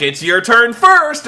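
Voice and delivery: high, loud and slow; mock taunting. Mocking wrestler telling another to go first. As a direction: egg him on. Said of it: "the one guy's like".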